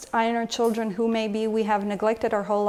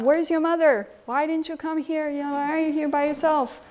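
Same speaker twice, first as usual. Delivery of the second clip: put-on higher, reproachful scolding of a lost child; shaming scold; 6 semitones higher than usual. Shown as a demonstration. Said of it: "Am I gonna shame the child?".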